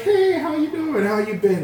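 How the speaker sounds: high, sing-song greeting; lightly voiced casual woman; imitating woman. Imitating the woman who texted him, high and breezy.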